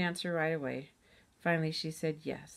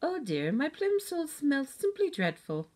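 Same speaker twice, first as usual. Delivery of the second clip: high, slow, pinched-nose snootiness; mock a snooty English girl, nose pinched; hoity-toity mimicry, pitched way up; 10 semitones higher, slower than usual. A narrator's reading. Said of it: "pinched her nose and said it in a hoity-toity voice".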